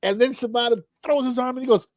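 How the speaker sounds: ordinary talk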